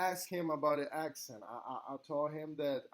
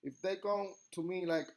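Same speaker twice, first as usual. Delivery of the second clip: normal talking voice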